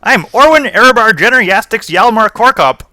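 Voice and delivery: high, loud, bubbly gnome greeting; eager gnome. Acted. Gnome wizard greets the party, high and overeager. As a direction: chirp it out like an overexcited little gnome.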